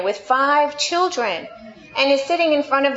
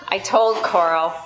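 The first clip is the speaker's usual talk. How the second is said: normal talking voice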